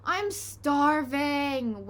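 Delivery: very slow drawn-out whine; wailed. A narrator's reading. Henry wailing to the family, slow. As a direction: stretch it out in misery. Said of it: "wailed Hard Henry".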